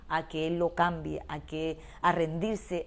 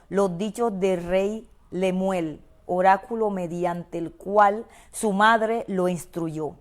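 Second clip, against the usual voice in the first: slow, louder, solemn reading of the verse; scripture reading; 7 dB louder, slower than usual. Acted. Preacher reading Proverbs to the congregation, slow and louder. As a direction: lay each word of scripture out slowly.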